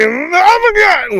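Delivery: very high, slow, strained yelp; Bobcat Goldthwait impression. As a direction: stretch it out in a strangled squeal. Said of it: "he just sort of talks like this".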